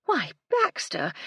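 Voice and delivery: high, slow, feigned astonishment; mock surprise. A narrator's reading. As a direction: act innocently taken aback. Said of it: "raised her eyebrows in mock surprise".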